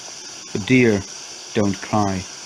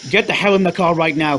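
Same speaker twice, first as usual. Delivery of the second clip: demanding; 6 semitones higher than usual. Acted. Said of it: "he demanded".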